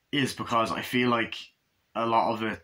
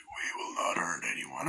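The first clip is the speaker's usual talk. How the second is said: low, much softer whispered threat; creepy whisper; 8 semitones lower, 9 dB quieter than usual. Retold or imitated. Reviewer voices the clone's eerie reply in a low whisper.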